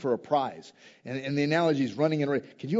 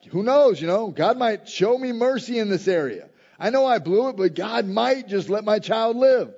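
desperate hope; 7 semitones higher, 4 dB louder than usual. Retold or imitated. David pleading, voiced loud.

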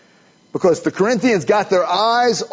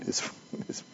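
whispered laugh; 9 semitones lower, 13 dB quieter than usual. Real, not acted. Laughing aside during explanation.

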